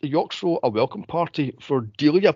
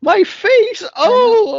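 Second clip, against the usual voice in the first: high loud hammed-up cry; high loud mock-wailing my face; mock shriek; 9 dB louder, much slower than usual. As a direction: shriek about her face. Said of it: "she goes".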